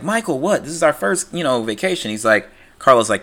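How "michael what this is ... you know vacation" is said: high, pleading complaint to her husband; whiny Connie. Retold or imitated. Connie whines at Michael about vacation, high and pleading. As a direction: Connie protesting the trip, pitched way up. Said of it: "Connie's like".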